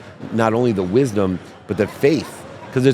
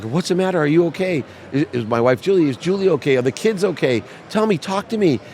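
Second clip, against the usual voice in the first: higher-pitched imitation of an anxious mom; worried mother; 7 semitones higher than usual. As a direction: press with concerned questions, voice raised in pitch. Acts out worried mother questioning him.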